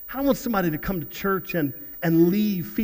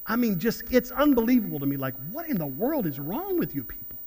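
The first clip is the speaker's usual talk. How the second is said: usual speaking voice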